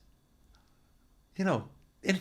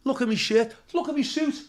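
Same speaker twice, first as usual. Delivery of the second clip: mock outrage; 8 semitones higher than usual. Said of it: "I knew straight away".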